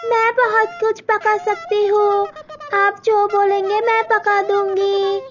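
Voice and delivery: high, obliging bride voice; meek young girl. Acted. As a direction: answer politely and eagerly. Malti answers.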